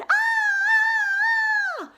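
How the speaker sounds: long, very high, loud scream; girl's scream. Acted. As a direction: shriek and hold it. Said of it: "heard the screams of Little Red".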